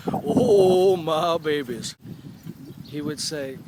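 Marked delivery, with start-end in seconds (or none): 0.1-1.9 loud, drawn-out "oh" in a grandpa impression; deep-voiced grandfather. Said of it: "he had this real deep voice".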